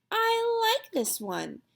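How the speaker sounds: put-on high, slow and smug, a girl claiming the rock; smug, owning it. A narrator's reading. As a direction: drawl it as if the park is yours. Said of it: "as if she owned the park".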